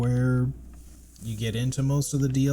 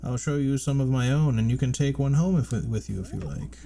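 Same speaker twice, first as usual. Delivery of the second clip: higher, louder fawning shopkeeper voice; Salubra voice; 5 semitones higher, 5 dB louder than usual. Acted. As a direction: play an eager old charm seller.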